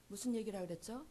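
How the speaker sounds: usual speaking voice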